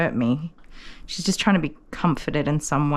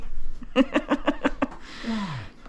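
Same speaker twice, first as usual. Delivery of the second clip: real chuckling laugh, pitched up; amused laugh; 4 semitones higher, 4 dB quieter than usual. Real, not acted. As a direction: laugh at the puppy's offended look. Laughs at the offended puppy.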